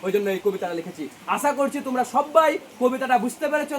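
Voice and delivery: usual speaking voice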